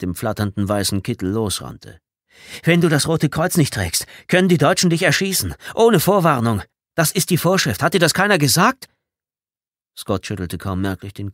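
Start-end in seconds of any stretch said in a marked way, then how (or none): 2.6-8.9 loud, high, agitated explaining; urgent warning. A narrator's reading. Tommy warning Scott the Germans may shoot, loud and agitated. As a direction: drive the rule home urgently.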